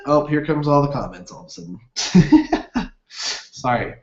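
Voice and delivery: ordinary talk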